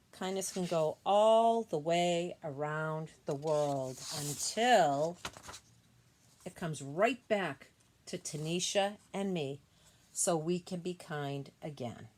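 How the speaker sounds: slow, swelling storybook read; read-aloud voice, growing grand; reading to children. Acted. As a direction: build the lines up big and slow for the kids. Reads book's closing lines.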